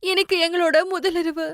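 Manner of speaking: high, tearful wail of a new widow; grief-stricken bride weeping for her husband; crying. Acted. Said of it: "அவ ரொம்பவே அழக ஆரம்பிச்சா".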